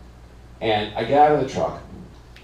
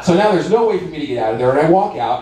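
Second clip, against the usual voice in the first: ordinary talk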